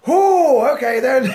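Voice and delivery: taken aback. Retold or imitated. His startled reply to God.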